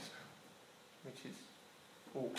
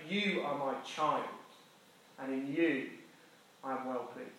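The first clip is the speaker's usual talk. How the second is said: tender voice of God; 3 semitones lower, 9 dB quieter than usual. Acted. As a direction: drop to a gentle loving blessing. God's blessing, climax.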